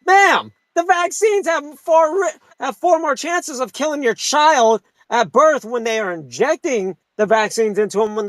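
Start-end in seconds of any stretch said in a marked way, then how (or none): 0.0-4.8 angry retort. Retold or imitated. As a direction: yell it back at her, dragging it out. Angry comeback to the aunt.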